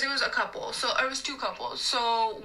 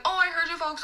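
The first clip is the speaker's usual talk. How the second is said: higher, friendly "I heard you folks"; friendly reassurance; 6 semitones higher than usual. Shown as a demonstration. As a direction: lift it into a friendly server's tone. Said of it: "but I was like".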